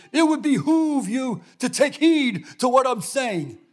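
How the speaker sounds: high, loud, slow pompous sergeant bark; drill sergeant. Retold or imitated. Sergeant barking orders at new recruits in formal words. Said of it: "this big sergeant got up and was yelling".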